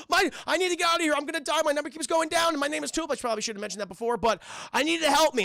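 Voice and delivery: high, fast, frantic babble; high fast panicked pleading; panicked Tulip. Retold or imitated. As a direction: blurt it out in a panic. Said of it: "She's like".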